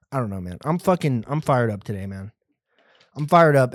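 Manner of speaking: normal talking voice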